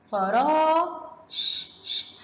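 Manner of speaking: very slow فرا, then ش as a pure consonant; sounding out. Shown as a demonstration. Teacher splits the word for pupils, sounding out فرا and ش. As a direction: stretch فرا high, then bare hushed ش sounds. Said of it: "سمعنا صوت المد".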